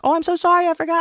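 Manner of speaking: mock falsetto sorry from the desk clerk; squeaky apologetic lady. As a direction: play the flustered nice receptionist, way up high. Voicing the nice desk lady.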